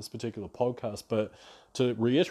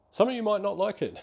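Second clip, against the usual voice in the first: laughing; 9 semitones higher than usual. Real, not acted. Self-mocking aside to listeners.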